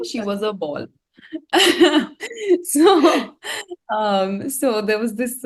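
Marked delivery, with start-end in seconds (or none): 1.3-2.6 burst of laughter. Real, not acted. Guest laughing as she recalls her report card, loud and high. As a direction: let a real laugh burst out.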